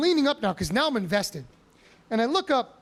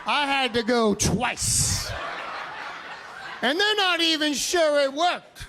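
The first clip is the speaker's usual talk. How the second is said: mock boast; 5 semitones higher, slower than usual. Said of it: "He's like".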